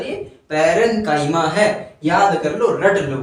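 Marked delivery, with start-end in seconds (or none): 0.5-1.4 पैरेनकाइमा with a hard "k"; correct "k" pronunciation. Shown as a demonstration. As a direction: stress the right reading, पैरेनकाइमा as "kaima". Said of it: "लेकिन ये गलत है sir".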